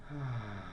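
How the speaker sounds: soft, low exhale of relief; long contented sigh; relieved sigh. Acted. Chlorine relieved after getting electron.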